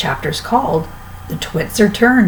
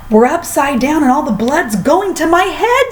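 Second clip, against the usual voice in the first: screamed; 8 semitones higher than usual. A narrator's reading. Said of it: "screamed Missus Twit".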